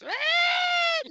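high, loud mock scream; rasping scream imitation. Explaining the scream was her asthma, mock screaming. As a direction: imitate the scream-like wheeze of bad lungs. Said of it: "making these noises".

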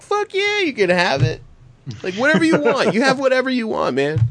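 high, giddy, over-generous yes; giddy drunk dad. Retold or imitated. Telling the kids they can have anything, giddy and high. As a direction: gush like a drunk happy dad.

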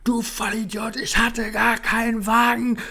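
high, loud, drawn-out mock yell; imitated screaming insult. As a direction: shriek the insult like a raging drunk. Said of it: "Und dann schrie er mich wieder an".

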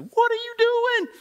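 very high, slow, drawn-out question; Holy Spirit's rebuke. Retold or imitated. Holy Spirit scolding, acted.

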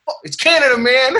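very high, hammed-up flag-waver; mock patriotic boast. Comic parodies a Canadian bragging like Americans, high mock boast. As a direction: whoop it like an over-proud patriot. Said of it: "it's just a tone".

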